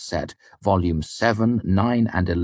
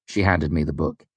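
plain reading voice

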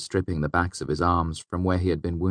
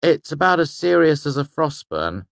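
disparaging; 10 semitones higher, 6 dB louder than usual. A narrator's reading. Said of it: "Bast said disparagingly".

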